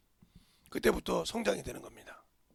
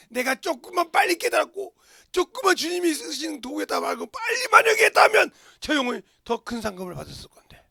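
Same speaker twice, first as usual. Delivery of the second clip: anguished regret; 9 semitones higher, 8 dB louder than usual. Retold or imitated. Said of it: "가슴을 치더라".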